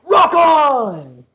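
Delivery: high, loud cringey rocker yell; mock rock shout. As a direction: bellow it like an edgy band member. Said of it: "You're like".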